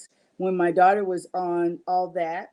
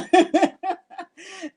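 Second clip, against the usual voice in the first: high laugh; 12 semitones higher, 4 dB louder than usual. Real, not acted. Laughing at her own joke.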